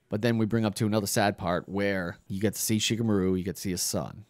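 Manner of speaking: ordinary talk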